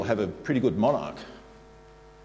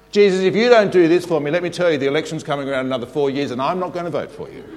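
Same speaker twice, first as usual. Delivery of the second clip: mock entitled prayer; 4 semitones higher, 5 dB louder than usual. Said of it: "we actually think that Jesus is our elected member".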